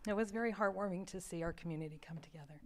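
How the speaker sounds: near-whispered, voice catching with emotion; too moved to speak aloud; choked up. Real, not acted. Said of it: "Sorry, I'm choked up".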